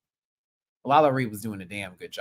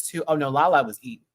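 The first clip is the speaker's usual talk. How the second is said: normal talking voice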